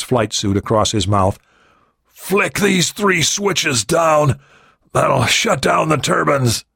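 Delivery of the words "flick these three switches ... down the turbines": wounded pilot voice. Acted. Wounded pilot giving instructions.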